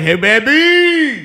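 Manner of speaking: high drawn-out flirty call; flirty come-on.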